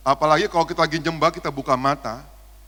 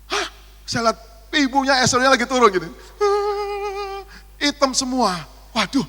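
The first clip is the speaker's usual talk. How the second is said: excited retelling; 9 semitones higher, 5 dB louder than usual. Retold or imitated. Retelling shock at running mascara.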